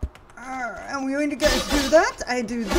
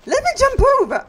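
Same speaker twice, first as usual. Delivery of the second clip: high, fast, loud demand; frustrated yell; 14 semitones higher, 8 dB louder, faster than usual. Real, not acted. Player pleading with the game to jump, high frustrated yell. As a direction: yell it rapidly, pitched way up.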